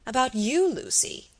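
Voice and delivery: a startled query, lightly acted; cried out. A narrator's reading. Husband questioning his wife in disbelief, crying out. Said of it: "cried Sir Michael".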